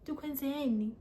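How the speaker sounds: ordinary talk, nothing marked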